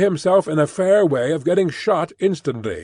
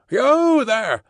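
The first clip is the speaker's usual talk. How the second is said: high guard's shouted warning; high, drawn-out armed challenge; roared challenge; 10 semitones higher, much slower than usual. A narrator's reading. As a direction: call out high to halt a stranger. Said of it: "as loud as he could roar".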